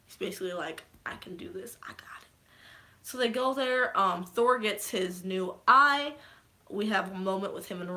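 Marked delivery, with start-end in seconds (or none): 1.1-2.3 very soft, almost whispered; hushed, understated confidence; quiet self-assurance. Retold or imitated. Voices Thor being sure of himself.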